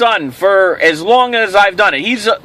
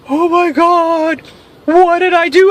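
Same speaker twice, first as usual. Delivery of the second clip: mock despair; 6 semitones higher than usual. Handcuffed, feigned despair.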